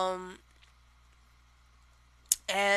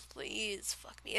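soft plea; 15 dB quieter, slower than usual. Shown as a demonstration. Quiet, slow demonstration.

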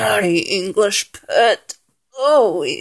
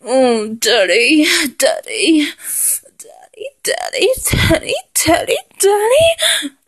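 whimpered pleading, climbing high; moaning and whimpering; 4 semitones higher than usual. Acted. Acting out a partner's response, moaning and whimpering higher and higher. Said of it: "Have you moaning and whimpering".

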